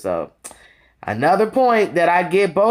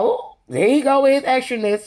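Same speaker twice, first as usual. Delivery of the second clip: lightly higher, teasing "ooh"; a hint of amused side-eye sass; sassy ooh; 8 semitones higher than usual. The host mocking Anthony's gossip, lightly sassy. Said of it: "So I was like".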